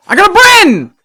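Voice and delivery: very high, loud burst; excited shout. Real, not acted. Player interrupts himself on getting a Bren, high excited shout. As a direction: whoop it out at full volume. Sudden outburst on getting the gun.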